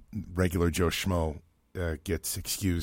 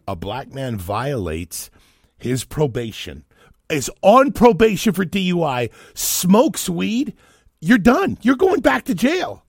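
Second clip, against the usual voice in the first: retold angry rant, building to a loud high shout; pissed-off friend; 11 semitones higher, 10 dB louder, slower than usual. Radio host retelling his friend's anger about probation, pissed off. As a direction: act out his worked-up outrage, getting much louder. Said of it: "This dude was pissed".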